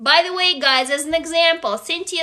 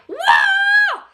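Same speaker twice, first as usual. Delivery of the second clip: high, loud battle yell; warrior cry; 4 dB louder than usual. Acted. Narrator voicing the goddess attacking the captors, loud high war cry. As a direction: let out a sword-swing war cry. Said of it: "and the warrior cry".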